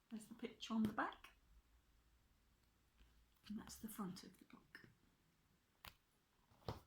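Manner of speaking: unvoiced whisper showing the book. Real, not acted. Parent showing children the book, whispered. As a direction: hush it while showing the cover. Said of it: "showing book to children".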